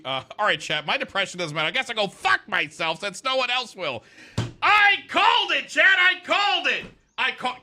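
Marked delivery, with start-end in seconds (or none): 4.6-7.6 much higher, louder victory yell; triumphant shout. Real, not acted. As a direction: shout it out, gloating that you were right.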